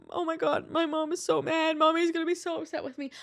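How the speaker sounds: high, hurried, put-on panic; squeaky, rushed fretting about an angry mom; panicked worrying. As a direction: spiral into mock panic, fast and high.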